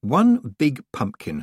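slow, pressing insistence; urging. A narrator's reading. Her second thoughts urging Tiffany to shoot, slow and pressing. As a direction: push her to act. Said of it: "her second thoughts urged".

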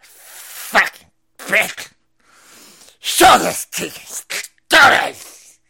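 slow, loud, high mock goblin voice; hammed-up goblin coworker yelling at the hot teacher; insufferable goblin teachers. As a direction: drag out the words like a snarling goblin. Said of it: "They go".

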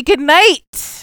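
loud, high show-ending farewell; mock sign-off. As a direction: wrap up the show with mock grandeur.

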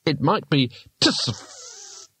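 slow, tentative guess; doubtful. A narrator's reading. Rincewind guesses at the rune's sound, slow and doubtful. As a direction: try the odd sound out slowly, unsure. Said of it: "said Rincewind doubtfully".

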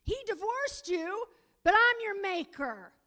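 loud, fervent sermon cry; preaching at full force. Acted. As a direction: rouse the congregation.

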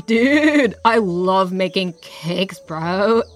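laid-back surfer bro, slowed; lazy, drawn-out dude talk. Acted.

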